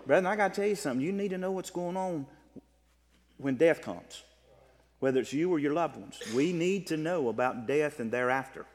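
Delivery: ordinary delivery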